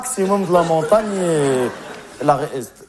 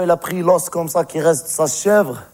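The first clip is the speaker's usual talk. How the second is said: normal talking voice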